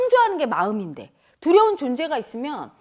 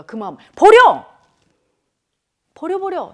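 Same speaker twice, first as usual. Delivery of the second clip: mock command; 4 semitones higher, 7 dB louder than usual. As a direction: command the feeling out. Mocking how people reject feelings.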